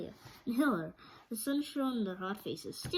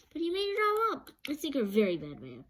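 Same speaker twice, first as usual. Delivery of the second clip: put-on accusing girl; 6 semitones higher, 4 dB louder than usual. A narrator's reading. Dorothy accuses the wizard.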